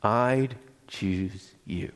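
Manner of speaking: slow, spaced-out closing words; tender declaration. Acted. Pastor closing the story for listeners, tender and very slow. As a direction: let every word land gently. Book's closing line, repeated after pause.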